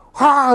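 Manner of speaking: high, loud, breathy "haa"; acted swooning sigh; adoring gasp. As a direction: imitate melting over a cute puppy. Imitates fussing over a puppy.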